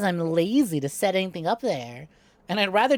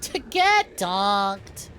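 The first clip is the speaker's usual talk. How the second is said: drawn out; much slower than usual. Real, not acted. Slow drawn-out gaming quip.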